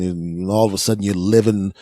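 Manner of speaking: ordinary talk, nothing marked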